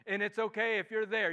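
ordinary delivery

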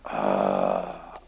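low drawn-out groan of reluctance; weary groan. Retold or imitated. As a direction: groan like dragging yourself out. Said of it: "we're just like".

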